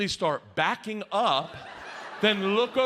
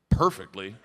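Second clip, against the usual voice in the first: ordinary delivery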